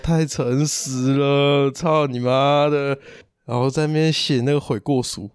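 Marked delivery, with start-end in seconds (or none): 0.0-3.0 slow, dragged-out muttered curse; inner grumble. Retold or imitated. Telling friend what he thought while writing, slow mock grumble. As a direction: drawl the self-mocking curse very slowly. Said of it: "我心想".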